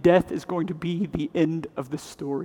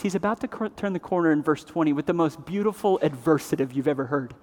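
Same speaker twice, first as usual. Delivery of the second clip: normal talking voice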